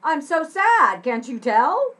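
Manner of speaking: over-the-top sarcastic wail. Shown as a demonstration. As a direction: exaggerate it so nobody believes you. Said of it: "kind of sarcastic".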